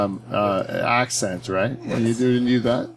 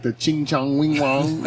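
mock Chinese accent; 3 semitones higher, slower than usual. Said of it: "if you do, like".

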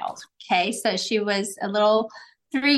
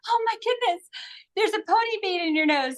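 surprised; 10 semitones higher than usual. Retold or imitated. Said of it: "I was like".

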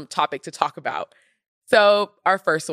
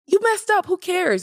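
exasperated; 12 semitones higher than usual. Real, not acted. Exasperated retort to self-deprecators.

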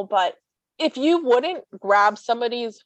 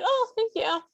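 sarcastic thanks; 12 semitones higher, 3 dB quieter than usual. Retold or imitated. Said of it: "I'm like".

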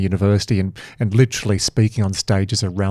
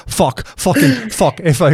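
higher-pitched mock Irish swearing; put-on Irish accent, pitched up and laughing; 8 semitones higher than usual. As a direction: imitate your co-host's Irish accent on the swear words. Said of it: "I can use in your Irish accent".

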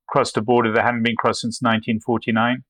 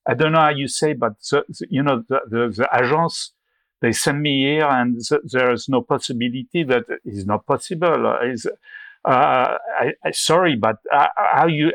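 put-on higher-pitched, halting French accent; 3 semitones higher than usual. Bluffing past press officials as a French agency reporter, halting French accent. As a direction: play a flustered foreigner with a French accent, fumbling for English. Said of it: "with a French accent".

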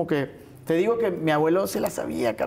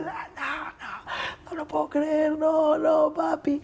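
high, broken wailing of disbelief; a retold sob, pitched way up; imitating mom's crying; 11 semitones higher, much slower than usual. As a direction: cry it out like a mother getting the news. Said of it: "o sea, llorando así".

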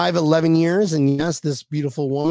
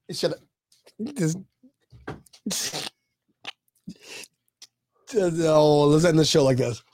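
usual speaking voice

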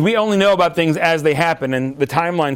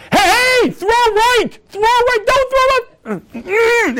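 screaming at the TV; much slower than usual. Pretend. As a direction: scream at the quarterback on TV. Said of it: "You're screaming".

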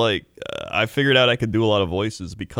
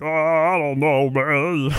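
slow, higher, reluctant sitcom husband; Ray Romano; 6 semitones higher, much slower than usual. Retold or imitated. Sitcom husband dodging his pal's bar invitation, slow put-on voice. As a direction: drag it out, hemming and hawing. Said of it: "surprisingly accurate Ray M-, Romano".

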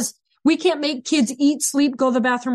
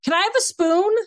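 lightly voiced kid's request, pitched up; kid asking; 5 semitones higher than usual. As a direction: ask like a kid, with a mild lift. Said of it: "When a kid says".

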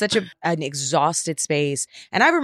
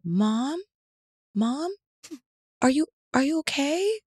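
higher, softer voice of a worried small son; gentle little boy; 9 semitones higher, 5 dB quieter than usual. Retold or imitated. Son gently checking on his mom lying in the dark, higher and soft. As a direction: ask gently, like a concerned child checking on mom. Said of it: "he was so, so gentle".

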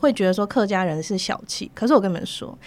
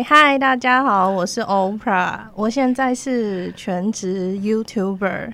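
slow intro; slower than usual. Real, not acted. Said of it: "不要有压力".

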